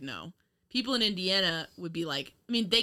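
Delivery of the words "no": low, soft dismissive no; flat refusal. Retold or imitated. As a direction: brush it off quietly. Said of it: "People in New York would be like".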